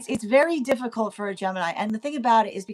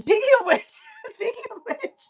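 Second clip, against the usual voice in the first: giggling, much higher; laughing; 4 dB louder than usual. Real, not acted. Reader noticing Taurus fits, laughing. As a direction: laugh through the phrase.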